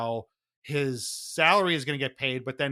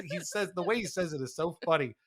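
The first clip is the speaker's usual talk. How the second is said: ordinary talk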